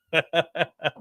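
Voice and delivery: breathy leftover laugh. Real, not acted. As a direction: still cracking up. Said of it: "Make myself laugh".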